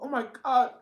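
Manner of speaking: high, loud mock groan of viewers; exaggerated high-pitched complaint, drawn out at the end; mock exasperation. Voicing viewers' reaction to corny videos.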